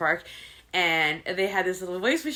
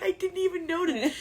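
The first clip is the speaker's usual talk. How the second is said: embarrassed reply; 9 semitones higher than usual. Retold or imitated. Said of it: "I'm like".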